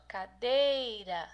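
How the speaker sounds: "cadeira" stretched on the syllable DEI; slow "cadeira", leaning on the middle syllable; stress on DEI. Shown as a demonstration. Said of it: "penúltima é a mais forte". ("cadeira" alongando a sílaba DEI)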